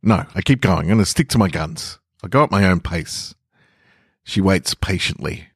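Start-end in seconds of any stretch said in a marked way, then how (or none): none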